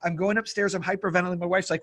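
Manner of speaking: ordinary delivery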